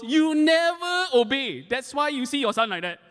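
mom's high-pitched reproach to her grown son; mom scolding. Retold or imitated. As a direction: drag out the reproach like a mother. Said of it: "And my mom goes".

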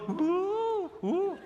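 long, high "ooh"; imitated dog howl. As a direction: howl like a dog hearing the van. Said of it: "and he go".

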